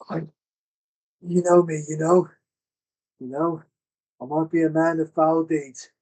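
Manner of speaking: begging villain. Acted. Fallen villain on his knees pleads with Griffin, wheedling. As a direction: bargain desperately for your life.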